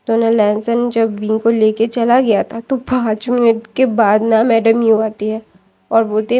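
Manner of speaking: ordinary delivery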